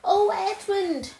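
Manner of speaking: high, loud, slow delighted cry; a girl thrilled to find her brother in the wood; excited cry. A narrator's reading. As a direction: cry out his name in joy. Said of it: "she cried".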